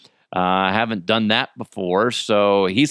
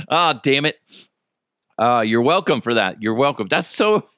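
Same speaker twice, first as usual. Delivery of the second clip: usual speaking voice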